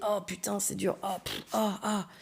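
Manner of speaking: quiet strained grunts; mock straining groans. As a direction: huff softly through a hard set. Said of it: "il m'entend faire des".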